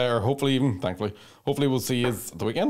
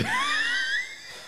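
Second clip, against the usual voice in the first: high squealing laugh; 4 dB quieter than usual. Real, not acted. Laughing at own joke.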